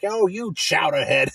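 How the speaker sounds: slow, Three Stooges-style jeer; old-timey movie insult. Retold or imitated. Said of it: "I love the old-timey, like, movies".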